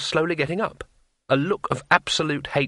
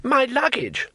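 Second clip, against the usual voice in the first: much higher, wailing tourist voice; alarmed protest; 12 semitones higher, slower than usual. Acted. Tourist dragged away from his belongings, alarmed. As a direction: cry out high in dismay.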